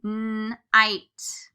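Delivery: teacher models night for the magic e spelling; modeling night. Shown as a demonstration. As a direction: say night slowly and clearly. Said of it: "magic E pattern".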